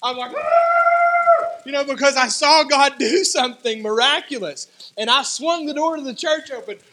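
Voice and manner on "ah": long, very high, loud scream; re-enacted scream. Preacher reliving seeing God do something miraculous, re-enacted scream. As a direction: let out a sustained high-pitched shriek of shock. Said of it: "I just screamed. I'm like".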